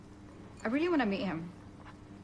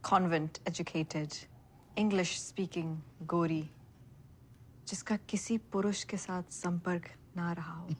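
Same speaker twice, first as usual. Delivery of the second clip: ordinary talk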